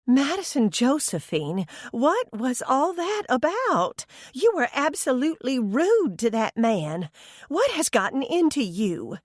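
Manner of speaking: high scolding mother voice. A narrator's reading. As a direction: chide her like a disappointed mom. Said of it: "she chided".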